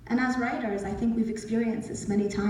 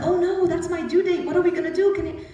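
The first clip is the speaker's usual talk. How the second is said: higher, louder retold mild panic; a little panicked; 8 semitones higher, 6 dB louder than usual. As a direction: replay a worried outburst, pitched up. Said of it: "I was a little panicked".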